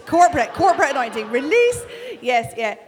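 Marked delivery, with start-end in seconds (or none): 0.1-1.8 loud, quick, climbing high on the last word; fervent proclamation. Real, not acted. Preacher releasing corporate anointing, fervent proclamation. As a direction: proclaim it over the whole room. Calling an anointing over the room.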